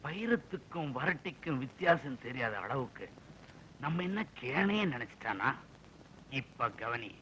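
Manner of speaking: low, hushed muttering to himself; quiet aside. Acted. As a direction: drop to a soft, scheming aside. Officer's aside, plotting a retort.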